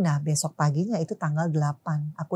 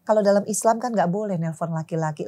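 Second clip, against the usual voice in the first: usual speaking voice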